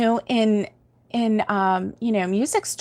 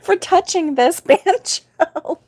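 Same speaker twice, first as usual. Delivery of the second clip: put-on high, laughing kid panic voice; grandson laughing; 6 semitones higher than usual. Grandmother quoting her grandson fleeing the store, laughing. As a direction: laugh through a scared little boy's line. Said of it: "he was like".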